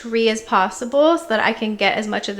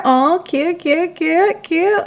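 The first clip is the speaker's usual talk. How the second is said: cooing; 6 semitones higher, slower than usual. Real, not acted. Cooing at photos.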